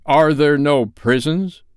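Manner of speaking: slow, loud, scornful throwback; mocking echo. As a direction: throw his words back at him. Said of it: "with his own words".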